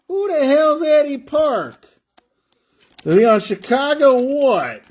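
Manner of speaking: high-pitched, puzzled outburst; baffled outburst. Real, not acted. Breaker stumbles on an unknown player's name, baffled outburst. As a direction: ask it high, in real bafflement.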